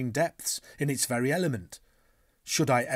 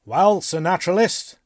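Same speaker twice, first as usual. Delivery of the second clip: higher, louder, lightly jovial greeting; slightly jovial; 7 semitones higher, 11 dB louder than usual. A narrator's reading. Canadian harpooner greets the naturalist, slightly jovial. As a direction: hail him with easy good humour. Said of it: "in a slightly jovial tone".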